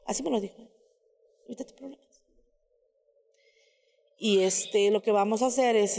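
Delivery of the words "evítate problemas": repeats the doctor's advice under her breath, much softer; a soft, bitter echo of his words; quiet echo of the doctor. Retold or imitated. Repite el consejo del doctor. (eco suave y amargo de las palabras del doctor)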